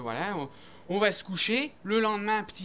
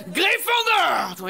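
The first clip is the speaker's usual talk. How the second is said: drawn-out, high, loud announcement; slow, high, loud sorting hat call; 10 semitones higher, 9 dB louder, much slower than usual. Acted. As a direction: boom it out like the magic hat. Sorting hat calls the house.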